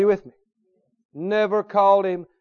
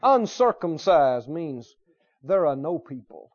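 ordinary talk

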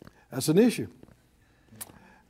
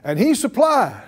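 higher, emphatic preacher's call; out-loud declaration; 4 semitones higher, 4 dB louder than usual. Real, not acted. Preacher leads everybody in affirming God provides, out-loud declaration. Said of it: "everybody say it out loud".

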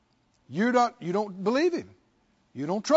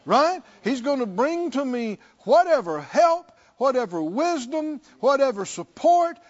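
loud, high, building sermon cadence; a preacher lifting the congregation; full-force preaching; 5 semitones higher, 4 dB louder than usual. Acted. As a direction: preach it out with rising fire.

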